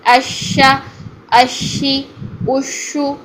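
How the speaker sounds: teacher demonstrating اشا اشی اشو; demo of shin with vowels. Shown as a demonstration. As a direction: model each sh syllable gently for students. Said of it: "ٹھیک ہے؟".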